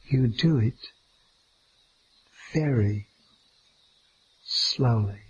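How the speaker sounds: unhurried, each word set apart. Shown as a demonstration. Demonstrating moving very slowly.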